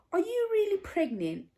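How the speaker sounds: high-pitched incredulous question; imitated disbelief. Daughter imitating mom doubting the pregnancy news, incredulous. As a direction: ask it high, as if still not believing.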